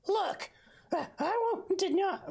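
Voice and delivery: very high put-on accented voice; accent for Bull. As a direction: squeak it out in Bull's accent. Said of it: "what voice did I give him".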